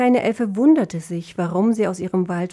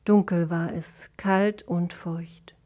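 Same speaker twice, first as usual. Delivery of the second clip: plain reading voice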